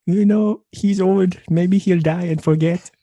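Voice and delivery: high, loud mock French; carefree in French accent; hammed-up French accent. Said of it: "That's a good accent right there".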